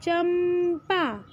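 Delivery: चंपा drawn out slowly, nasal चं held; teacher stretching चंपा to show the anusvara; slow demo of चंपा. Shown as a demonstration. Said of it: "हाँ, चंपा".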